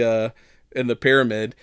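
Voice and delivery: normal talking voice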